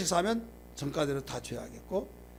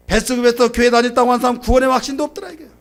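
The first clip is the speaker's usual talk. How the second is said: loud, high, fast pulpit punchline; preacher's raised, emphatic declaration; forceful preaching; 8 semitones higher, 11 dB louder, faster than usual. Real, not acted. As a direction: hammer it out in one rushed breath.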